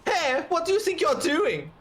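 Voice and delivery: put-on high, indignant German accent; German-accented captain. Captain scolding his stuck soldier, indignant German accent. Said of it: "that was a German accent".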